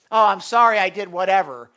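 loud, breezy "sorry I did whatever"; mock dismissive sorry; cop-out apology. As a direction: brush off the apology carelessly. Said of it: "That is a cop-out".

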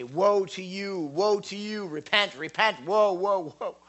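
high wailing cry of woe; prophet crying woe. Retold or imitated. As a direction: call out woe like a doom prophet. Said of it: "And he would say".

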